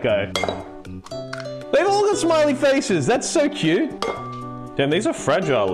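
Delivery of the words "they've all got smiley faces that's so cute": high cooing over cute eggs; delighted squeal. Real, not acted. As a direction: coo with delight.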